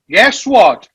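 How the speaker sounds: normal talking voice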